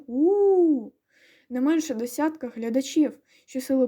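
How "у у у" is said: long, high, loud "ooo" cheer; approving crowd "ooo". A narrator's reading. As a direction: whoop it out like a cheering audience. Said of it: "загукало схвальне".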